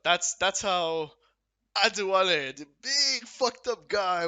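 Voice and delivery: proud showman announcing his alter ego; hyped-up persona intro. Real, not acted. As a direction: build up the big reveal, loud and high. Hyping up his Adewale persona.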